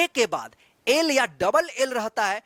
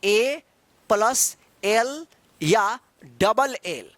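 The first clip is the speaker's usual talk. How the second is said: slow, deliberate teacher reading of the rule; dictating the rule word by word; much slower than usual. Shown as a demonstration. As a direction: spell out plus L या double L very slowly. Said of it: "तो ये rule है".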